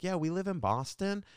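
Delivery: high-pitched mock girl voice; playful high imitation of young women; imitated young women. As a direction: pitch it well up to mimic the girls.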